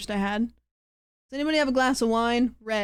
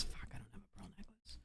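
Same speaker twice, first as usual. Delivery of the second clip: whisper; 2 semitones lower, 25 dB quieter, faster than usual. Real, not acted. Muttered aside about missing necklace.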